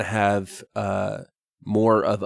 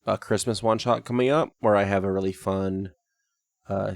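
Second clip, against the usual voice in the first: normal talking voice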